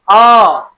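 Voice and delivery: अ held out with the tongue in the middle; central vowel. Shown as a demonstration. Teacher repeating the middle vowel for pupils, held अ. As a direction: sustain a mid-tongue अ. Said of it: "जीभ बीच में है".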